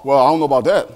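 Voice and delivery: low, lazy excuses, voice dropped; mock the member dodging work; reluctant churchgoer. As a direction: churchgoer backing out of service. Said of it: "But as a sign".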